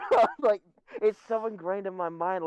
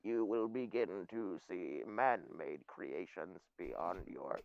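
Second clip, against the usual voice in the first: low, slow, soft otherworldly voice; a deep ominous prophecy, slowly; eldritch horror; 12 semitones lower, 11 dB quieter, slower than usual. Acted. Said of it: "that genuinely freaks me out".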